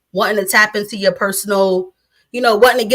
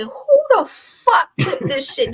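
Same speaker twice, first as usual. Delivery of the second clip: higher, slower mock disbelief; incredulous quote; 8 semitones higher, slower than usual. Finding the clip of themself online, higher slow disbelief. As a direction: draw out the disbelief. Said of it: "I was like".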